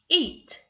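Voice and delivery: EAT high and loud, on one beat; one clapped beat. Shown as a demonstration. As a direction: punch EAT as a single syllable. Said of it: "I'm going to clap as I say the word".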